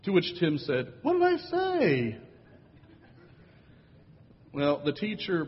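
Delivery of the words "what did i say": put-on high, slow, wide-eyed little boy; a small boy baffled by the fuss; innocent child voice. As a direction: ask it like a puzzled kid. Child Tim's innocent question.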